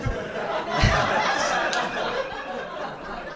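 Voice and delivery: breathy high-pitched laugh. Real, not acted. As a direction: laugh at your own line. Laughing at own retort.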